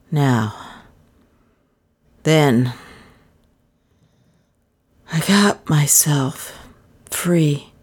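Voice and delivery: very slow, halting, a few words at a time; dazed, tongue-tied stranger. A narrator's reading. Dazed stranger explains how he was gagged and escaped, halting. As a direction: labour each phrase with long gaps between.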